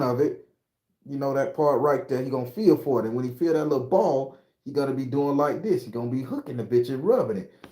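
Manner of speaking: a hint of a cocky loa speaking through him; possessed Baron voice. Streamer lets the Baron lecture viewers, lightly voiced. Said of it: "I don't talk like this".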